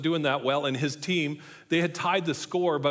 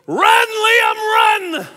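wife screaming at a runner; imitated full-volume, high-pitched yell; loud cheering; 13 dB louder, much slower than usual. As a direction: shriek it high, urging him on. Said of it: "she's cheering so loud".

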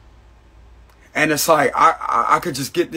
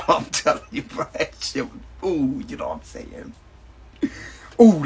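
laughing while talking; 4 semitones higher than usual. Real, not acted. Laughing at his own words.

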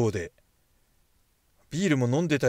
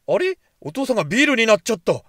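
a sudden high squeak of surprise, then louder; surprised; 8 semitones higher, 8 dB louder than usual. Real, not acted. Player seeing dad vanish, surprised squeak. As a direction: caught off guard, voice jumping up. Father turned into beer.

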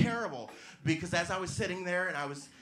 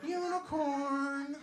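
drawn-out, much higher dreamy word; mock wonder; 12 semitones higher, much slower than usual. Comic calling the G spot a myth, dreamy high wonder. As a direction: float it like a fairy tale.

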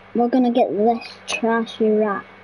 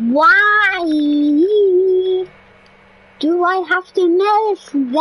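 loud, higher "why"; frustrated cry; 5 semitones higher, 4 dB louder than usual. Real, not acted. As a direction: let the frustration burst out. Frustrated at missing something in game.

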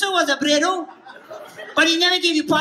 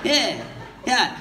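usual speaking voice

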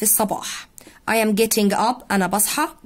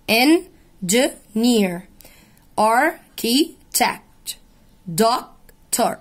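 engineer stretched very slowly, en-gi-neer; syllable by syllable; much slower than usual. Shown as a demonstration. Teacher breaking a word into syllables for students, very slow. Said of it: "فبص كلمة farm اتقسمت far ومر".

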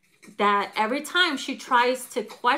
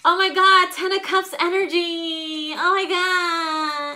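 high, drawn-out swooning gush; gushing girlfriend; 7 semitones higher, 4 dB louder, slower than usual. Acted. Girlfriend swooning over their photo, high slow gush.

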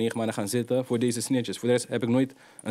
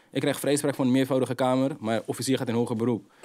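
usual speaking voice

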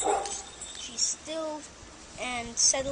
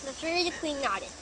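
plain reading voice

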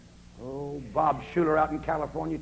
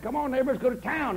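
calling neighbor; 9 semitones higher, 4 dB louder than usual. Retold or imitated.

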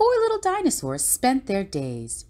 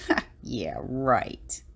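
low, soft sarcastic scoff; dismissive, unimpressed, dropped low and quiet; shrugging disbelief; 6 semitones lower, 5 dB quieter, much slower than usual. A narrator's reading. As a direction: brush it off with a dry little ha. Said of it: "just shrugged and said".

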